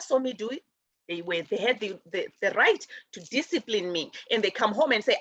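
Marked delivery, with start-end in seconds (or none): none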